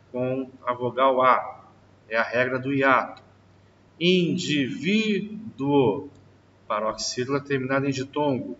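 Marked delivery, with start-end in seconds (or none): 4.0-6.1 slow drawn-out word. Shown as a demonstration. Teacher slowly stretches the example word for the class. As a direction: stretch every syllable slowly. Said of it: "teacher drawing out example word".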